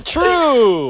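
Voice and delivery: loud, high game-show call; announcer call. Shown as a demonstration. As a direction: belt it like a quiz host. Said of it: "Let's try that again".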